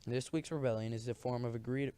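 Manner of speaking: ordinary delivery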